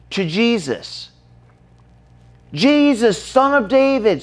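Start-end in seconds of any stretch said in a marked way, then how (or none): 2.5-4.2 high-pitched mock calling out; imitated cry for mercy. Blind man calling to Jesus, mildly imitated scream. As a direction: call out high, only half screaming.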